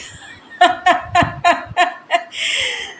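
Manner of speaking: high burst of laughter; real laughing fit. Real, not acted. Vlogger catches herself saying खैर, high laughter. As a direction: laugh out loud at your own slip. Caught saying khair once more.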